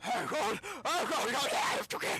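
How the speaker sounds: high, loud cries of terror; frantic panicked yelling; panicked scream. Acted. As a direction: scream it as if being chased. Acted victim fleeing, screaming.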